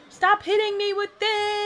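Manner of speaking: high, loud, drawn-out yell; frustrated shout. Real, not acted. Getting hit in the race.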